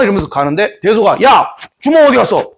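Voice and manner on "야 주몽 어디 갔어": 화난 호통. Acted. 대소가 화내며 찾음.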